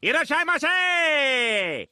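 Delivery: yelled sushi greeting. Acted. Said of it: "I just want to yell at customers".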